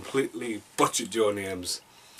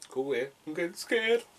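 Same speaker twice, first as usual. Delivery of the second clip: higher, softer pretend fright; mock scared; 5 semitones higher, 6 dB quieter than usual. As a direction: play at being scared by a tagline. Jokingly reacts to scary tagline.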